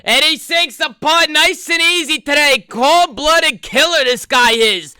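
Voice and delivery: high, loud, drawn-out call; slow, big triumphant call of the putt; excited golf commentator. Pretend.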